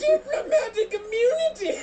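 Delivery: wizard spell cast. Pretend. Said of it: "saying Expelliarmus".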